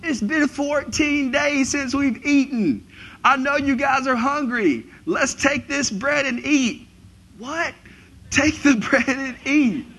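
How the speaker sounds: high, loud, drawn-out holler; shouting over storm. Acted. Paul urging starving sailors to eat in a storm, strained high shouting. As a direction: bellow it high as if tossed about by waves. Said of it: "as he's being tossed and turned".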